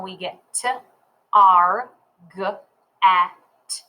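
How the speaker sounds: "T, R, G, E, T" as separate letter sounds with gaps; finger-spelled sounds. Shown as a demonstration. Teacher finger spelling target, isolated sounds. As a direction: sound out T, R, G, E, T one at a time. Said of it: "If we finger spell".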